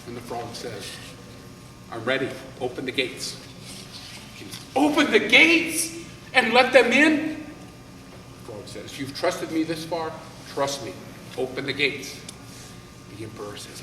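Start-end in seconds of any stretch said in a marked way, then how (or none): 1.9-3.4 low frog voice. Acted. Frog calmly answers the king. 4.8-7.5 higher, louder and slow, disbelieving; a king who can't believe the request; incredulous king. Acted. King incredulous at frog's plan. 9.0-12.2 calm frog. Acted. Frog reassures the king.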